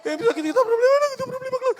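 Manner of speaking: high, whimpering imitation; mock tearful prayer. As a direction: pretend to sob out troubles in prayer. Mimics tearful prayer about problems. (kunwaring umiiyak na panalangin)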